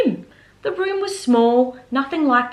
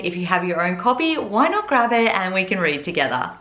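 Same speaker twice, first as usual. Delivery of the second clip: ordinary delivery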